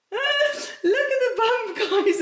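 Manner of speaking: high, slow laughing squeal; delighted laugh to the viewers. Real, not acted. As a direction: giggle as you show it off. Showing off bump, laughing.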